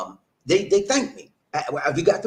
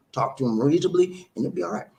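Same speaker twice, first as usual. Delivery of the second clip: usual speaking voice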